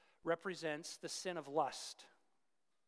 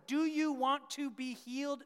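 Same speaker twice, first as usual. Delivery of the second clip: pushed higher and louder, pressing hard; loud pressing question; 8 semitones higher, 8 dB louder than usual. Retold or imitated. Preacher to congregation, loud pressing question. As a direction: demand an answer from the crowd. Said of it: "And if you ask them".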